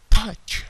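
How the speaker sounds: Touch with a loud burst on the first t; explosive t in Touch; plosive t. Shown as a demonstration. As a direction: pop the t of Touch hard and loud.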